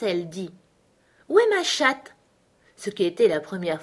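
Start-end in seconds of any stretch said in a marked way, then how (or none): 1.3-2.1 put-on very high little-girl voice; Alice's textbook question. Alice tries a textbook phrase on the mouse, high-pitched.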